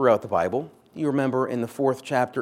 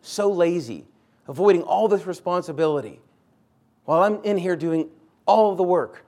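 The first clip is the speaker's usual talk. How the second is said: rising, louder mock grumble of an overworked sister; indignant Martha; 5 semitones higher, 6 dB louder than usual. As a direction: huff about lazy Mary, climbing in pitch and volume. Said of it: "Martha's sitting there going".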